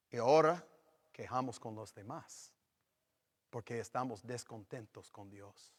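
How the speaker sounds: normal talking voice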